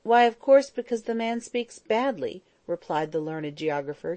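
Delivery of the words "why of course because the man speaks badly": higher, emphatic retort; exasperated, loud at the start; getting impatient. A narrator's reading. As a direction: snap back with growing impatience. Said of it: "getting impatient".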